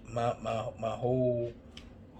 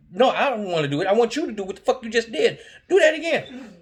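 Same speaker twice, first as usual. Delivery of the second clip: loud, higher pleading demand; insistent yell; 9 semitones higher, 6 dB louder, faster than usual. Retold or imitated. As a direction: push it hard, insistent.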